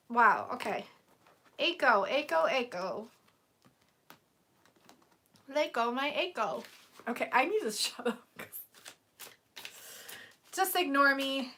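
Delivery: ordinary talk, nothing marked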